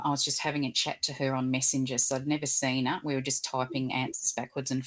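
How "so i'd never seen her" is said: ordinary talk, nothing marked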